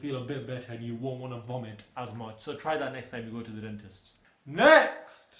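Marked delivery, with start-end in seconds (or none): 4.4-5.3 loud call. Acted. Calling the next meme.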